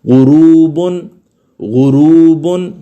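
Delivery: गुरूबुन with a thick, full ghain, even before a zer; slow deliberate गुरूबुन with a full throaty ghain; heavy ghain. Shown as a demonstration. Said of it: "यानी मोटा पढ़ा जा रहा है".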